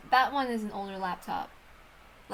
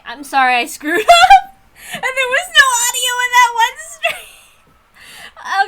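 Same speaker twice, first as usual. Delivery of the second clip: much higher, laughing and half-crying through the apology; 12 semitones higher, 11 dB louder, slower than usual. Real, not acted. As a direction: giggle out an embarrassed sorry, voice cracking. Apologizing for screwed-up stream audio.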